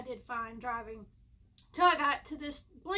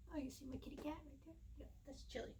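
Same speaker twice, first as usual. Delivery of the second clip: hushed fast whisper; real hushed aside about the cat; 2 semitones higher, 15 dB quieter, faster than usual. Real, not acted. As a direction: whisper so the cat stays put. Aside pointing out her cat.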